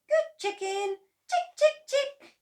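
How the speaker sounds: very high coaxing call; coaxing chicken. A narrator's reading. George coaxing a chicken, very high. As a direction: coax it gently.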